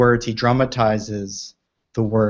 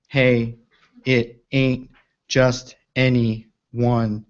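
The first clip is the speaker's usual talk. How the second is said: very slow, each one-syllable word given its own weight; slowed-down reading; much slower than usual. Shown as a demonstration. Host reading the poem aloud to listeners, very slowly. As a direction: let the words drop one by one, unhurried. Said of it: "He's slowing us down".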